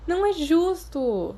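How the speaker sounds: slow, high whiny complaint; complaining. A narrator's reading. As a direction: whine it out like a sulking kid. Peter complains about homework. (reclamando devagar, agudo)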